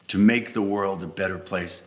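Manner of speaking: plain reading voice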